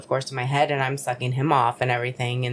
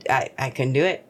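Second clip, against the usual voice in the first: ordinary talk, nothing marked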